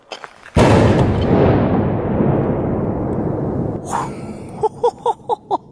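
long, high, loud burst of laughter; echoing whoop of a laugh; delighted laugh. Real, not acted. Echo test in water tower.

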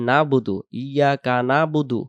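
"না'বুদু" as plain nabudu, no throat sound; mistake without ain. Shown as a demonstration. Teacher showing what learners say, "না'বুদু" without the ain. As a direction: skip the ain and say "না'বুদু" quickly. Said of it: "যদি আমরা বলি".

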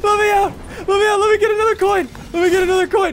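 high, loud frantic cries; panicked excited shouting; frantic excited yelling. Real, not acted. Infected chasing, frantic coin grabbing.

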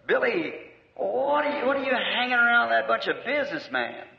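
high, astonished questioning of Billy; a retold friend, pitched well up in surprise; incredulous friend. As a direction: act out the friend's disbelief. Friend mocks preacher among businessmen.

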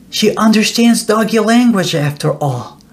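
usual speaking voice

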